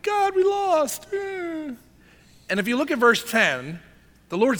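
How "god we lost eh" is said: high, drawn-out mock whine; whiny tantrum. Preacher mocks Joshua's pity party before God, whiny. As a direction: wail it like a sulking child, hammed up. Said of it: "having a little temper tantrum before God".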